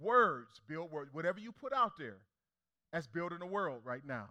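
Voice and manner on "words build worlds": slow. Shown as a demonstration. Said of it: "I gotta give you something".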